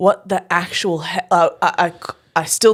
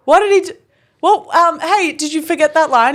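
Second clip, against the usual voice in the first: high, louder baffled questioning; puzzled disbelief; 10 semitones higher, 4 dB louder than usual. Retold or imitated. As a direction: call out in mock bewilderment. Retelling their puzzled reaction.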